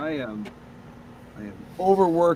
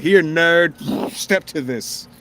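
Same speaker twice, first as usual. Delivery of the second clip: mock challenge; 6 semitones higher, 6 dB louder, much slower than usual. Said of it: "and be like".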